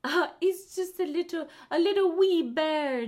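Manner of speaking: a playful put-on Scots voice; Scottish accent. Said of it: "in love with the freaking accents".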